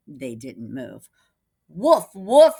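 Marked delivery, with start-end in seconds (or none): 1.7-2.5 loud mock dog bark. Cat faking a dog bark at the birds, loud. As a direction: bark like a dog to scare them. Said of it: "he cried".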